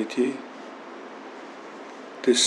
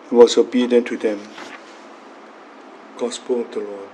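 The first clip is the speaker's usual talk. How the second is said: steady, solemn proclamation of scripture; Gospel reading; 6 dB louder than usual. Acted. Priest reading the Gospel at Mass, solemn and measured. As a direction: read the Gospel aloud to the congregation.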